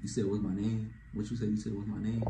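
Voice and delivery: low, soft, casual asking back; smooth, quiet mock confusion; acting like he didn't hear. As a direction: coolly ask it back, keeping it low. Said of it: "act like you couldn't hear her".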